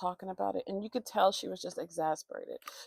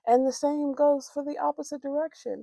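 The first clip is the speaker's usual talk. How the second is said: ordinary delivery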